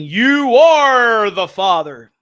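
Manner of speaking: very slow, loud, drawn-out verdict; hammed-up daytime TV host; mock talk-show reveal. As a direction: announce it like a paternity reveal. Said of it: "They're like saying".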